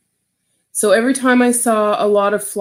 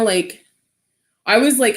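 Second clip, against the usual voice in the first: normal talking voice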